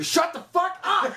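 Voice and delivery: high, drawn-out comic yell; mock yelling. Pretending to yell back at her, high mock yelling. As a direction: yell it slowly at a very high pitch, half laughing. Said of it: "What if you start yelling at her".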